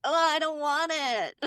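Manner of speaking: high, drawn-out childish protest, mocking him; whiny child. Host mocks husband refusing sunscreen like a child, childish whine. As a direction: imitate a kid refusing, high and slow. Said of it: "he's like the tenth child".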